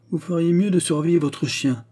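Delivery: put-on girl scolding the brigadier; irritated cry. A narrator's reading. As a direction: snap at the brigadier. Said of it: "cria la jeune fille irritée".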